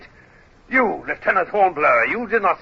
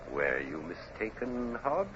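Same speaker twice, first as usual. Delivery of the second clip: menacing hush; 3 semitones lower, 14 dB quieter than usual. Acted. Captain's menacing soft question.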